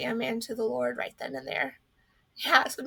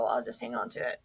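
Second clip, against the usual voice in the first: muttered thought; 14 semitones lower, 6 dB quieter, faster than usual. Retold or imitated. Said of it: "and I just thought".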